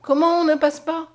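high, loud cry of alarm; frightened. A narrator's reading. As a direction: recoil in alarm at being refused. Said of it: "reculant effrayée".

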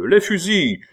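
loud, high-pitched exclamation; eager call. A narrator's reading. The troop agrees and clamours for guns, eagerly. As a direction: call out keenly, pitch jumping up.

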